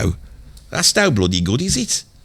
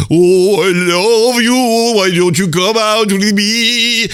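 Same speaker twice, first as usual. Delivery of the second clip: high, slow crooning lover; mock Italian serenade; 6 semitones higher, much slower than usual. Ralph serenading the girls under their windows, slow crooning. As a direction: serenade the girl at her window. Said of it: "he's going".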